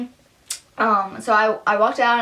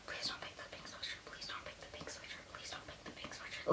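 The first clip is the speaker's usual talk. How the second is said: urgent pleading whisper; 25 dB quieter than usual. Retold or imitated. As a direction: whisper it over and over like a wish. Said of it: "I was, like, whispering it".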